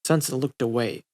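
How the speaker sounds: usual speaking voice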